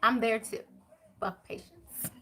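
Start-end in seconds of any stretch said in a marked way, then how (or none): 1.2-2.1 breathy whisper, no voice at all; whispering the curse so the spirits won't hear. Retold or imitated. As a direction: say it under your breath, half-guilty. Repeats the curse under her breath.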